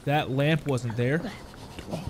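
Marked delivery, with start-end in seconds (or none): 1.9-2.0 scared hushed whisper. Real, not acted. Hears creepy noise in game.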